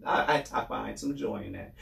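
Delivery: usual speaking voice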